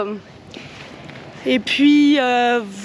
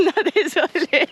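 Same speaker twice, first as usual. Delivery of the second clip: high, helpless giggle on the words; cracking up at your own slip; laughing; 4 semitones higher than usual. Real, not acted. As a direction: apologise through real laughter. Laughs at her own wrong word.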